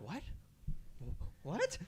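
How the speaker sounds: gopis glancing around, baffled; confused what. Acted.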